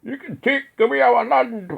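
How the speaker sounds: high, loud hammed-up Scottish Braveheart line; mock Scottish accent. Quoting Braveheart to listeners, in a hammed-up Scottish accent. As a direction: belt it out in a put-on Scottish accent. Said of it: "I won't do a Scotch accent".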